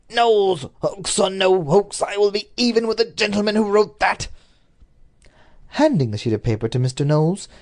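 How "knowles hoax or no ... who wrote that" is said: trembling vow. A narrator's reading. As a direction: let the voice shake as you swear to get even. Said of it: "in a voice which actually trembled".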